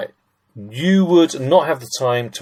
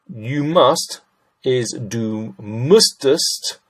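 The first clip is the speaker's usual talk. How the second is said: ordinary delivery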